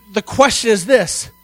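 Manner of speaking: ordinary talk, nothing marked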